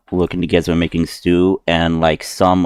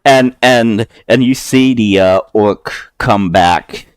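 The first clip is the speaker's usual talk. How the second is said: ordinary delivery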